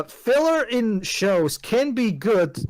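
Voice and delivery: ordinary delivery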